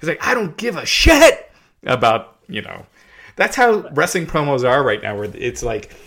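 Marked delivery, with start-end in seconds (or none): 0.3-2.4 hammy promo voice leaning on "shit"; overemphasized cuss word. Retold or imitated. Mocking how wrestling promos cuss, stressing "shit". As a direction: stress on "shit", pitched higher and louder. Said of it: "they really overemphasize the cuss word".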